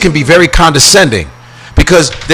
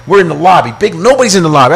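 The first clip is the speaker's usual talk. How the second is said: ordinary delivery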